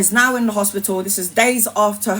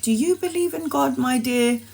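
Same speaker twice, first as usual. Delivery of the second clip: gentle nurse; 4 semitones higher than usual. Retold or imitated. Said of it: "she was like".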